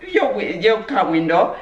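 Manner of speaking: usual speaking voice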